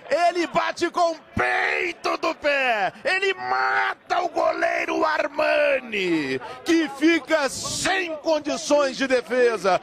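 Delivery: goal celebration shout. Real, not acted.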